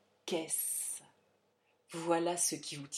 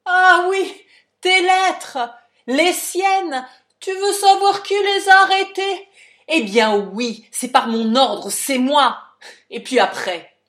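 furious shouting confession; 11 semitones higher, 19 dB louder, slower than usual. Acted. Father confesses in rage.

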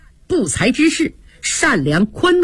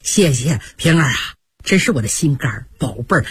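lower, fast fawning sweet talk; butter her up, quick and low; fawning thanks; 8 semitones lower, faster than usual. A narrator's reading. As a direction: Jia Lian fawns on Ping'er, lower and fast. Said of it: "满脸堆笑".

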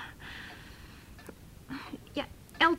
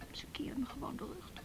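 hurt whisper; 16 dB quieter than usual. Real, not acted. Hurt, repeating the snub.

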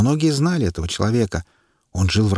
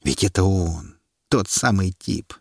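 usual speaking voice

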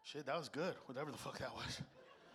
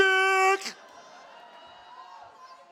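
loud high sigh; 7 dB louder than usual. Real, not acted. Big exhale ending the bit.

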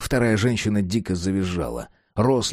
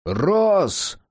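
high commanding call; 9 semitones higher than usual. Acted. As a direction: call him from the door. Voice calls from the entrance.

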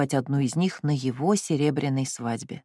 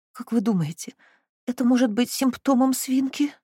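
whimpering; 8 semitones higher than usual. Acted. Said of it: "всхлипнула кузина Стиклс".

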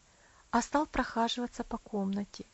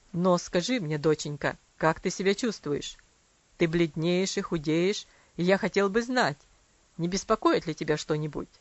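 softened father; 5 semitones lower, 5 dB louder than usual. A narrator's reading. Father voiced tenderly.

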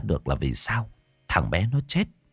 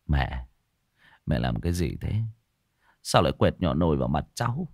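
lower, softer voice of a son-in-law reproaching; reproachful frown; 6 semitones lower, 5 dB quieter than usual. Acted. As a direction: chide her with a frown in the voice. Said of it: "cau mày trách". (con rể cau mày trách mẹ vợ, giọng trầm và nhỏ)

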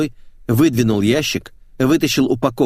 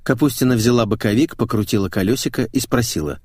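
ordinary delivery

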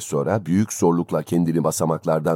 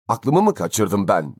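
usual speaking voice

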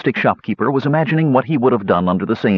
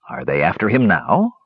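ordinary delivery, nothing marked

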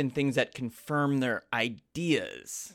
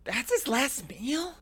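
high disbelieving question; surprised; 9 semitones higher, 3 dB quieter, slower than usual. Real, not acted. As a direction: ask it slowly, astonished. Shocked at last meal.

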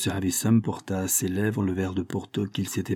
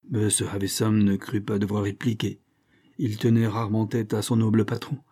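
ordinary delivery

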